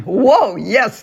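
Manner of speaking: loud, high "whoa"; mock surprise. Teacher reacting to the training topic, loud mock surprise. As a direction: act amazed at the big topic. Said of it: "reaction to topic".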